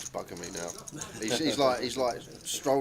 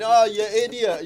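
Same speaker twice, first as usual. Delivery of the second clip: higher, louder, slow mock Scottish scolding; mock Scottish accent; 10 semitones higher, 9 dB louder than usual. Scottish mate scolding friend hit by bus, imitated Scottish accent. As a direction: drawl it out in a put-on Scottish accent like a mate scolding his pal. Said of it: "says his name with a thick Scottish accent".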